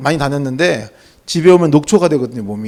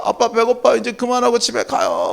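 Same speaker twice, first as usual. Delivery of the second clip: put-on higher-pitched pleading kid; higher, whiny childish begging; child losing patience; 4 semitones higher, 5 dB quieter than usual. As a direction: imitate a child who cannot wait any longer. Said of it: "참기 힘든 제가".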